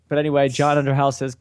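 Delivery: normal talking voice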